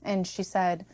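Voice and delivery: ordinary delivery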